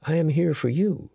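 a hint of a gentle woman; Samandi's voice. A narrator's reading. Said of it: "she said".

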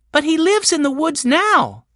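put-on high-pitched boy's voice; child character objecting. A narrator's reading. Benny questions the storeman's visit, in a high child's voice.

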